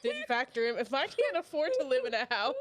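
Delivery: laughing through the words; talking while cracking up. Real, not acted.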